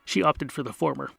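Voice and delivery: usual speaking voice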